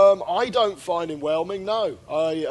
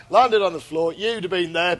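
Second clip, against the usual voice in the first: usual speaking voice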